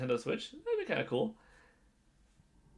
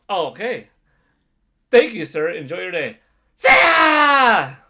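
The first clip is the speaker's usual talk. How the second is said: cheery drive-through worker; 8 semitones higher, 18 dB louder than usual. Pretend. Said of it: "so when they say".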